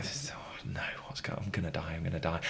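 retold frightened whisper to himself; panicked whisper. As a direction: whisper it as if hiding in fear. Hiding, terrified, talking to himself.

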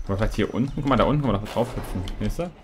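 ordinary talk, nothing marked

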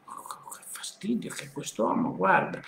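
annoyed whisper under the breath; whispered aside. Real, not acted. Wife complaining about her husband aside, whispered. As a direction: hiss it so he won't hear.

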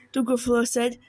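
plain reading voice

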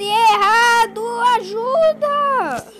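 pleading loudly to be rescued; panicked plea. Acted. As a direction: beg for help in alarm. Girl thinks bus is wrong.

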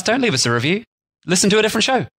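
rising high mock exasperation; mock rant. Real, not acted. Comic rant at bad raters.

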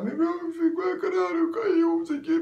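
very high, wailing panic; mock despair. Patient imagining a fall after surgery, high wailing despair. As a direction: squeal it in exaggerated desperation. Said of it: "maior desespero".

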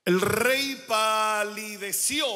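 "palideció" drawn out slowly and loudly to its last O; stress on final O. Shown as a demonstration. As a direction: stretch "palideció", landing hard on the Ó. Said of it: "con acento en la O".

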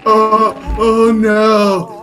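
high, loud, very slow moan of alarm; drawn-out dread. Real, not acted. Player watching friend go in, loud drawn-out dread. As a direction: stretch out the dismay loudly.

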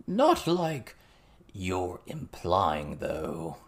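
slow, hedging frog-like goblin; croaking goblin. A narrator's reading. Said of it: "in his frog's croak of a voice".

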